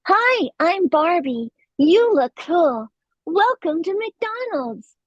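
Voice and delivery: high, slow bubbly doll voice, every word stretched; a cheerful doll greeting customers, drawn out high; Barbie voice. Shown as a demonstration. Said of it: "And I went".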